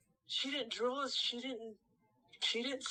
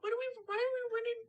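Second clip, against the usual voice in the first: pitched way up and rushed, baffled; high bewildered question; 13 semitones higher, much faster than usual. Retold or imitated. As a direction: blurt it out fast and high, lost.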